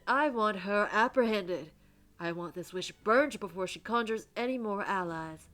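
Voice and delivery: loud, emphatic order; angry command. Acted. Reverend ordering the witch seized, loud and angry. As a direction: demand it with rising force.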